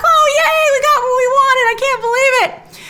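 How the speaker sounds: very high, loud fan squeal; gushing in a squeaky put-on high voice; mock elation. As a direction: imitate overjoyed fans cheering. Imitates fans' delight at the kiss.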